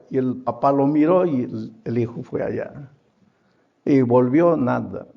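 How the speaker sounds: ordinary delivery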